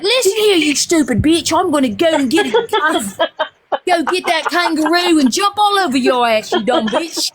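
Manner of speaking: mock Australian insult, slowing down at the end; mock Aussie cussing out. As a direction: threaten like a cartoon Aussie, laughing. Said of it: "She'd be like".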